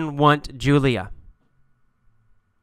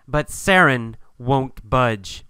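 ordinary delivery

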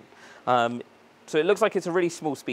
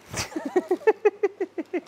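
high, rapid run of giggles; staccato high laughter; giggling laugh. Real, not acted. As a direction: burst into a long high-pitched chuckle. Laughs at own tubular bells joke.

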